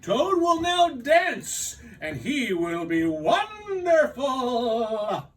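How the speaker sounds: high, slow, shouted showman announcement; booming announcer. Acted. Strange voice announcing the dream star, booming and slow. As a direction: call it out like a circus ringmaster. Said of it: "said the voice".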